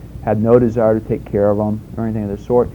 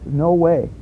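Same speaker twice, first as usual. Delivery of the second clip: ordinary talk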